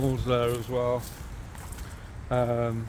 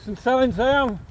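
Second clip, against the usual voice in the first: high, loud friendly correction; calling out; 11 semitones higher, 4 dB louder than usual. Real, not acted. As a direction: call back proudly that you're local. Calling to stranger.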